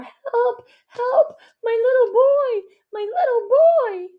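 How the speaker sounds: high desperate screaming with a sob. A narrator's reading. Mother screaming for her little boy in anguish. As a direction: wail for her fallen son. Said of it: "The woman is screaming louder than before".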